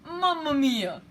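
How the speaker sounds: hammed-up Italian; Italian exclamation. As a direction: cry it out like an Italian. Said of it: "It's how he talks".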